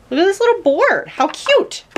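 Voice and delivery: high, loud squeal over something cute; delighted cooing. Real, not acted. Squeals over a cute game board.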